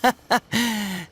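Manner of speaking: short, indulgent laugh; amused chuckle. Acted. Host hears his friend boast, amused chuckle.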